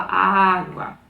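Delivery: "Água" said slowly and clearly, each syllable shaped; clear model word. Shown as a demonstration. Mother modelling the word "Água" for her toddler, slow and clear. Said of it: "Então fala".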